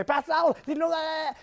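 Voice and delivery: a hint of a breathless teen girl; excited girl voice. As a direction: mimic a gushing teenager. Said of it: "She'll come up and she'll just say".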